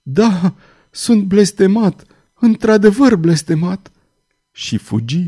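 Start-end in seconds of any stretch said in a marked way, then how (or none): 0.0-3.9 high, pained admission; slow, high-pitched lament; anguished murmur. A narrator's reading. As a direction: accept the curse in despair. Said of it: "murmură el".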